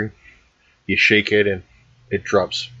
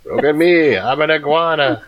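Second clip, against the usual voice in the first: iguana; 5 semitones higher than usual. Pretend. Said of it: "My iguana impression".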